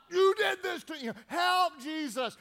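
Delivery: loud, high imitated cry; hammed-up animalistic cry for help; calling out. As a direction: wail it as a desperate plea. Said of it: "these guys are calling out".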